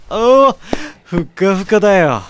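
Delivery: loud, high cry of delight. Acted. Passenger testing the bed, loud delighted cry.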